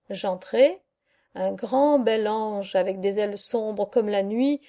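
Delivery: ordinary delivery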